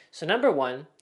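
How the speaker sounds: ordinary talk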